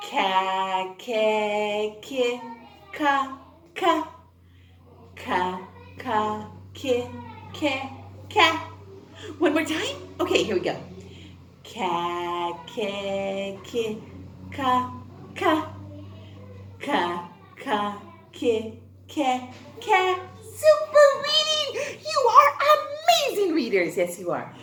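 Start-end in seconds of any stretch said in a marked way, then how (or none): 0.1-20.2 steady syllable-by-syllable drill; phonics drill. Acted. Teacher drilling the class on K syllables, steady and even. 20.6-24.0 high, loud praise with a laugh; big praise. Acted. Teacher cheering her young readers, high and loud.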